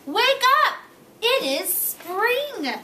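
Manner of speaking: high and loud, slowing on spring; shouting. Acted. Frog shouting to wake Toad, excited. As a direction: shout him awake with joy. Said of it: "shouted Frog".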